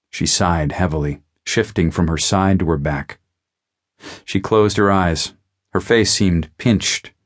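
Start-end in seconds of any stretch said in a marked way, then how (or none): none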